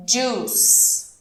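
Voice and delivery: "Juice" as "djoos", the I barely heard; correct pronunciation. Shown as a demonstration. Teacher models the correct "Juice" for students. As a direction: model the right "Juice" with no I sound. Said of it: "esse I, eu quase não falo ele".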